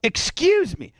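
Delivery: indignant high-pitched echo of the words; mocking excuse me. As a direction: sneer it back in a high mock voice. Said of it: "Warren then goes".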